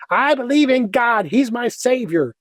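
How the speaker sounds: high, hammed-up declaration of faith; professing American believer. Dutch guest acting the churchgoer getting along in society, mock fervent. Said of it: "You have to say".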